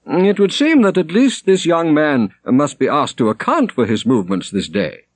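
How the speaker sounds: high, loud pronouncement; a prior claiming authority; decreeing prior. A narrator's reading. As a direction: lay down the law. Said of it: "decreed Robert".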